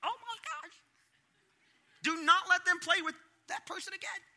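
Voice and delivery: high, loud panicked squeal; hammed-up parental panic; shrill alarm played for laughs; mock freak-out. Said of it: "I freak out with Jill".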